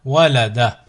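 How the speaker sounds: syllables joined as one word. Shown as a demonstration. Teacher models a word for learners to repeat, joined in one go. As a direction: run the syllables together without pauses. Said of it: "ensuite le mot".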